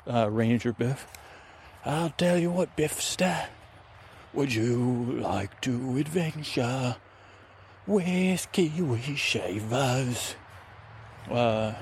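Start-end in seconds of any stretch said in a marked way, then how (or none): 1.9-10.4 coaxing, drawn-out adventurer; Kiwi Shavers character. Acted. Adventurer inviting the ranger along, slowing to a drawl. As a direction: stretch out the invitation in a put-on voice.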